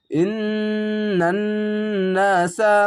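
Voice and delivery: ইন্নান held very long, then নাসা; slow Quranic recitation. Shown as a demonstration. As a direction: draw ইন্নান out slowly. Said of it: "কলকলা ধাক্কা মেরে". (ধীরে টেনে ইন্নান নাসা)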